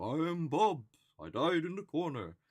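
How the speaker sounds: high, loud mock ghost announcing itself; pop-up ghost. Ghost pops up in front of ghost hunter, high mock proclamation. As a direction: sing out like a ghost introducing itself.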